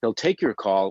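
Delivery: ordinary talk, nothing marked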